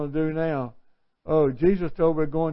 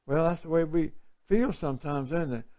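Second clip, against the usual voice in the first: ordinary delivery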